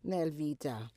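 ordinary talk, nothing marked

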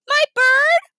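high, loud, astonished question; a little kid piping up in surprise; calling out. A narrator's reading. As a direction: squeal it as a question. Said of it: "Fudge called from under the table".

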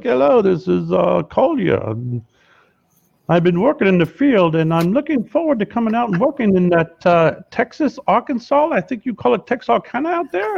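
prank call as a strange-sounding VP; put-on oddball executive accent, rising high; weird VP accent. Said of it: "really kind of weird accent".

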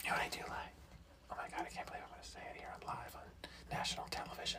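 hushed, barely audible whisper; confessional whisper. Pretend. Confiding a guilty preference on air, confessional whisper. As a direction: whisper it like a secret you should not be sharing.

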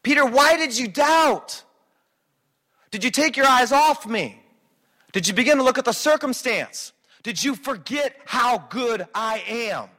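high, loud, slow rebuke; rebuking Jesus. Retold or imitated. Jesus rebuking Peter on the water, loud slow rebuke. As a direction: call him out for doubting. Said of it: "He goes".

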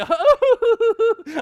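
high burst of laughing; really cracking up; loud real laughter. Real, not acted. Laughing at Titanic scene.

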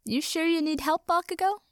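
high, lilting teasing question; playful fairy needling a friend. A narrator's reading. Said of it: "she teased".

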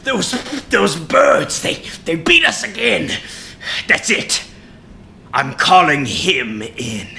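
hammed-up villain fury; angry villain. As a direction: storm at the crew like a cartoon overlord. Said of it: "and he's like".

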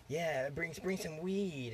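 hint of a party girl reading out her text; lightly voiced girl texting.

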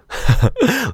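bright burst of laughter; high laugh at himself. Real, not acted. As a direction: break into a squeaky laugh. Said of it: "Like at the end of the R and B songs".

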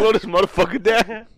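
high, loud passenger's protest; mock alarmed shout. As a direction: yell at a reckless driver, hammed-up. Acting a scared passenger in the car.

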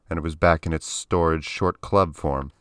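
ordinary delivery

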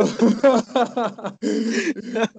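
drawn-out high laugh. Real, not acted. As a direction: laugh after teasing someone. Laughing after teasing a student.

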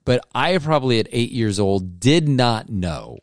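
ordinary delivery

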